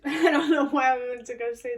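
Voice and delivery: amused laugh through words. Real, not acted. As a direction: laugh at your own slip. Laughs at own slip.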